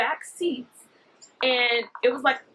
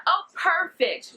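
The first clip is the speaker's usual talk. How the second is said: higher, drawn-out mock delight; fake-friendly stranger; 6 semitones higher, much slower than usual. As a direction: stretch it out high, mimicking the woman. Acting the woman's pleased reply.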